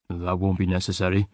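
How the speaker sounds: cold and toneless, giving nothing away; flat, expressionless. A narrator's reading. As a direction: shut him out with an empty voice. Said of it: "Stephen's tone was flat and expressionless".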